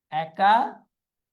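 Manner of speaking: Academy very slowly as "eka ... demi"; broken into parts. Shown as a demonstration. Teacher dictating the word, slowly in parts. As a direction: split academy with a long pause inside.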